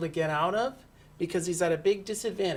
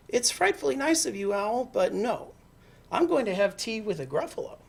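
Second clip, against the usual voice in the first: lightly voiced mouse, higher; polite mouse; 6 semitones higher than usual. Mouse turns down Owl's tea, polite and higher.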